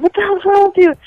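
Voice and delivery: high-pitched mock scolding; retold yelling. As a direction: act out an irritated boyfriend. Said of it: "after he yelled at me".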